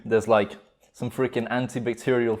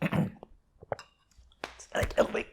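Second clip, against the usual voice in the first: hoarse whisper; 9 semitones higher, 9 dB quieter, much slower than usual. Real, not acted. Choking on spicy soup, coughing.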